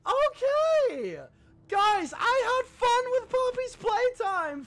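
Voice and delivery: very high, drawn-out celebrating voice; euphoric squeal. Real, not acted. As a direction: squeal it out in delight. Celebrates beating the game.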